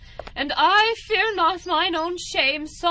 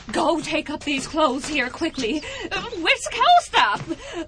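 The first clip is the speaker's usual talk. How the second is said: usual speaking voice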